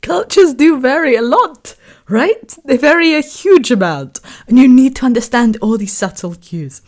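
still amused. Real, not acted. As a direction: keep the giggle in the voice. Recovering from laughter, elevated.

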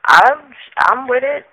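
unsure. Real, not acted. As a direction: agree slowly, as if not quite convinced. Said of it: "you don't sound too sure".

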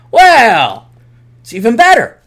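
loud, high, drawn-out "well"; hammed-up triumphant retort; gleeful comeback. Acted gleeful comeback to Atham.

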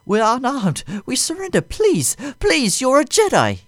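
high, loud panicked begging; terrified pleading. A narrator's reading. Aide begging Vader on the floor, high panicked plea. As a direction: plead for your life.

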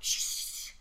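long, sharp shush; imitating a sibling's warning shush; hushing. Sister shushes noisy little brother, hushing.

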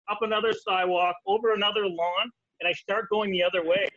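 ordinary talk, nothing marked

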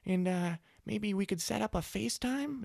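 high-pitched tentative mom impression; imitated mom. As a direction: imitate Mom asking politely, voice pitched well up. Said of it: "she's like".

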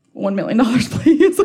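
cheeky ask dissolving into laughter; laughing joke. Retold or imitated. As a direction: joke it and laugh halfway through.

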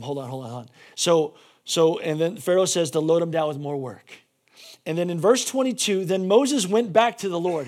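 ordinary delivery

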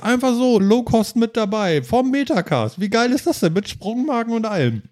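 high, thrilled gushing; excited. Real, not acted. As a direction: sell it like great news. Excited about new feature.

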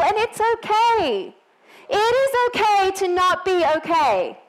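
high, emphatic sermon delivery; impassioned preaching. Real, not acted. Preacher telling church it is okay to hurt, impassioned.

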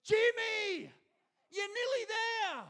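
high, loud mock cheer from the roadside; cheering a friend on. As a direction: yell it like a mate jumping out of the bushes. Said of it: "and goes".

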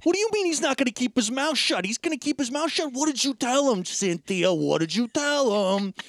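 high, frantic mock interrogation; flipping out. Imitating Joyce confronting Cynthia outside the house, flipping out. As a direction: pitch it up and hammer out the demands. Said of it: "where Joyce flips on her".

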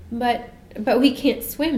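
stammering, slow and scared; frightened child. A narrator's reading. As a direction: stutter it out in fear. Michael frightened by the seal.

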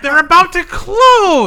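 high, loud, pressing yell; urgent shout. Acted. Customer pressing others as the stand closes, urgent shout. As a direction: shout it as time runs out.

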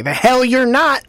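hammed-up outraged veto; mock indignation. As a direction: play a dead booster rising to object. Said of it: "rise from his grave and say".

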